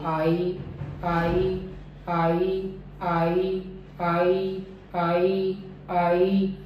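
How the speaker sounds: आई as one smooth glide from "aa" to "ee", no gap; आई sound demo. Shown as a demonstration. Teacher drilling the closing diphthong, steady gliding आई. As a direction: repeat आई evenly as separate model syllables. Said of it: "तो जो आई sound है मेरी".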